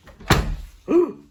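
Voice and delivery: high, loud hiccup gasp; a hiccup returns with a jolt; acted hiccup. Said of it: "It didn't work".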